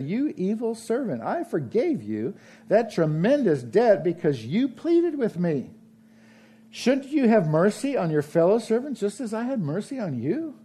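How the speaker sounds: high, forceful rebuke of the servant; king condemning the evil servant; angry king. A narrator's reading. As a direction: scold him from the throne.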